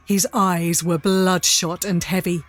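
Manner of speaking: ordinary talk, nothing marked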